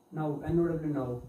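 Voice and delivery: ordinary delivery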